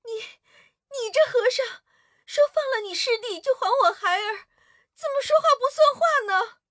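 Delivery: angry princess. A narrator's reading. Princess angrily reproaching the monk who broke his promise, high-pitched. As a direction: scold the monk for breaking his word. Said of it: "公主生气地说".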